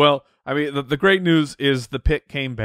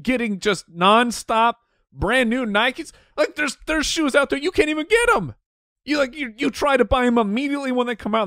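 much higher-pitched sneakerhead excitement; excited gushing; 11 semitones higher than usual. Real, not acted. Sneaker fan on shoes nobody can get, excited gushing. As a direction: gush in a high, incredulous pitch. Excited over getting brand new Nikes.

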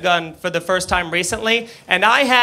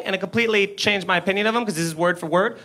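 normal talking voice

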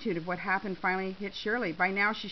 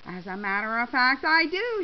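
loud, rising triumphant reply; smug; 3 semitones higher, 8 dB louder than usual. A narrator's reading. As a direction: answer smugly, punching up the end. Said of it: "Shirley said smugly".

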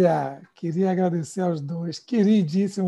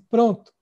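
pronto curto e firme; 4 semitones higher, 6 dB louder than usual. Shown as a demonstration. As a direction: diga pronto como ok, tudo bem. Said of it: "a pessoa fala assim". (pronto dito com firmeza baiana)

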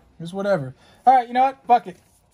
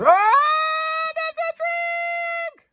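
long drawn-out high shout; excited shout; much slower than usual. Real, not acted. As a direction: belt it out in triumph. Drinking game card pull shout.

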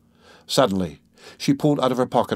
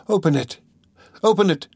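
higher, urgent cry for help; calling out; 9 semitones higher, 3 dB louder than usual. A narrator's reading. Dunya trapped and shaking the door, calling out. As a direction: call through a locked door. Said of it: "she called, shaking the door".

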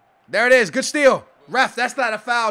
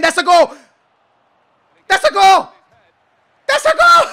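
loud, high goal cry climbing higher; jubilant shouting; 11 semitones higher, 12 dB louder than usual. Real, not acted. Gamer celebrates scoring, jubilant escalating yell. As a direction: yell it bigger each time until laughing.

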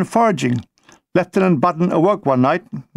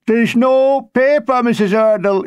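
a lightly voiced old man calling to the landlady; blind old man; 5 semitones higher, 3 dB louder than usual. A narrator's reading. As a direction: call out forlorn from the WC door. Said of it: "he said".

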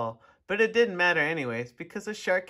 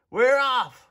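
loud high cheer; group shout; 8 semitones higher, 7 dB louder than usual. A narrator's reading. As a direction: shout it out as the ship sets sail. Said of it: "we all shouted".

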